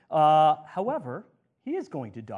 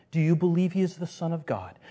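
ordinary delivery

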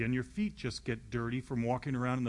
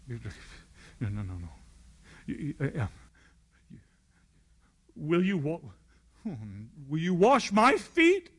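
flustered stammer; 4 semitones higher, 7 dB louder than usual. Acted. Peter stammering at Jesus kneeling.

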